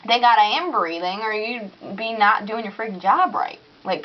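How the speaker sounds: usual speaking voice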